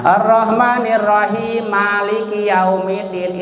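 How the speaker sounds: chanted "Rahim Maliki" with only one mim; dropped letter. Shown as a demonstration. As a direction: merge "Rahim Maliki" into rahi-maliki. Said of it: "hilang satu huruf". ("Rahim Maliki" dengan mim pertama hilang)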